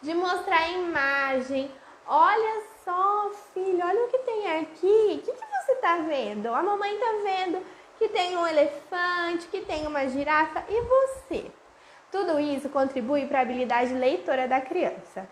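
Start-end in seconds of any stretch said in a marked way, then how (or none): 2.1-11.5 put-on higher playful mommy voice asking the child; mom reading to child. Shown as a demonstration. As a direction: coax a toddler to look at the page. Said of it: "isso que a gente faz, de mostrar".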